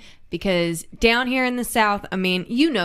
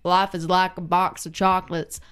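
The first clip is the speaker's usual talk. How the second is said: put-on Alabama Southern accent; 3 semitones lower than usual. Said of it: "such a thick Alabama Southern accent".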